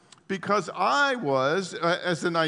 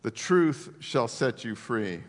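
slow solemn quote; 6 semitones lower, slower than usual. Acted.

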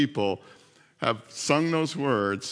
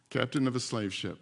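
ordinary talk, nothing marked

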